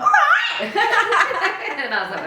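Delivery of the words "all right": high squeal breaking into laughter; laughing squeal. Retold or imitated. Quoting friend, bursts laughing.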